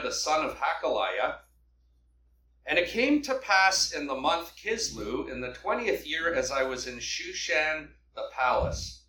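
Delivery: ordinary talk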